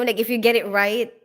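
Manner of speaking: usual speaking voice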